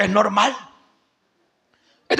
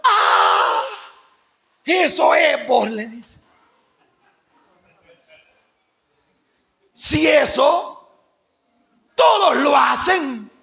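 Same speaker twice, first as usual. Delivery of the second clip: dismissive doctor; much slower than usual. Retold or imitated. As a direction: wave away the sin as nothing. Doctor quita importancia al pecado. (doctor que le resta importancia, alto y lento)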